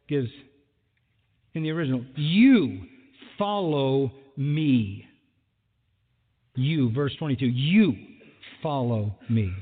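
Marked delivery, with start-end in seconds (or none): 2.2-5.1 "You" hit loud, then "follow me" said slowly; emphatic you. Acted. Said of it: "this is emphatic". 7.5-9.5 "You" hit loud, then "follow me" said slowly; emphatic you. Acted. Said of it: "this is emphatic".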